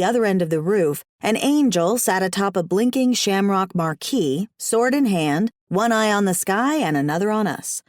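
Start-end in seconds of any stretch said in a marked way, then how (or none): none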